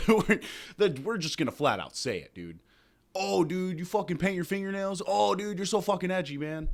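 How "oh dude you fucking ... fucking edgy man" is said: mocking fan voice. Mocking Nirvana fans.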